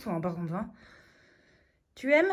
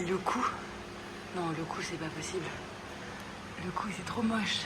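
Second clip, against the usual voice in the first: whispered; 5 semitones lower, 12 dB quieter than usual. Real, not acted. Complaining about her sunburn.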